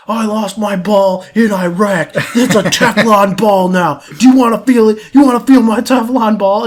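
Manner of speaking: high, loud, raving outburst; mock angry rant. Veteran shaking the kid at the bar, loud mock anger. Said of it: "he gets real angry".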